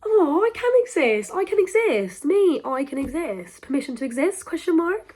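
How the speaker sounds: high, loud elated cry; thrilled, half-laughing discovery; joyful realization. Retold or imitated. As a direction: burst with relief and joy. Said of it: "and I was like".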